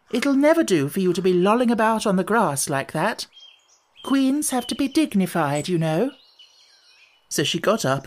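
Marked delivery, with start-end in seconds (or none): 0.1-6.1 lightly severe, higher-pitched Alice; severe scolding. A narrator's reading. Alice scolding herself for lolling on the grass, severe. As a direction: scold with emphatic, high-pitched peaks. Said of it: "she went on in a severe tone".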